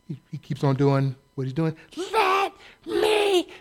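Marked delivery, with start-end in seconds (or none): 2.1-3.5 high, slow mock shriek; mock scream. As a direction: shriek it like a hysterical protester. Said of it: "screaming at the cop".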